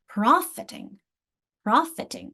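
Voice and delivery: "Profiting" with a strong PROF; "Profiting" as PROF-it-ing; first-syllable stress. Shown as a demonstration. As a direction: hit PROF at the start of "profiting". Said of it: "Where's my accent in the beginning?".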